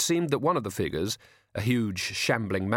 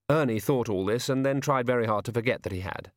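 plain reading voice

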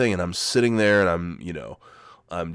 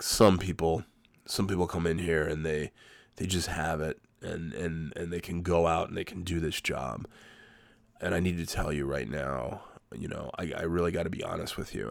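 hushed, solemn boss imitation; real serious; 3 semitones lower, 8 dB quieter than usual. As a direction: let him down gently, dropping your volume. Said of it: "He gets real serious".

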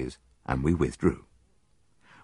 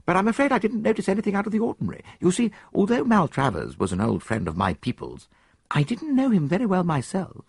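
put-on higher, quick eager young man's voice; young soldier; 14 semitones higher than usual. Soldier answers the detective, higher and quick. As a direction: play the keen young officer, pitched up.

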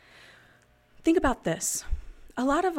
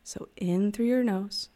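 guided breathing; 4 dB louder than usual. Acted. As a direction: talk them through each breath, unhurried. Leads a breathing exercise.

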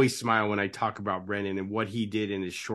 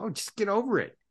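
dismissive brush-off, pitched higher; mock dismissive; 9 semitones higher, 3 dB quieter than usual. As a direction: toss it off lightly, like it's nothing. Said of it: "tell a client ... and go".

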